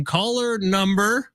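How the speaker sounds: slow, stretched call-out with a rising end; drawn-out tease. Acted. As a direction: stretch it out like a radio host building suspense. Radio host drawing out caller number.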